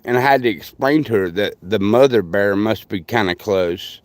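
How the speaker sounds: usual speaking voice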